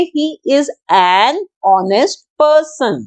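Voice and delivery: "he is an honest person" read very slowly and clearly; teacher modelling the full sentence; slow example sentence. Shown as a demonstration. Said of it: "हम बोलेंगे".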